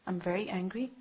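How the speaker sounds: a quiet, restrained reply to an angry partner; calm, quiet reply. Shown as a demonstration. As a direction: say it low and steady to defuse. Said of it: "tell them".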